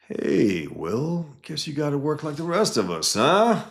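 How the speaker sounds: rising, needling jab ending in a high loud huh; teasing coworker. Retold or imitated. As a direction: poke fun at the new guy. Coworker teasing Will in kitchen.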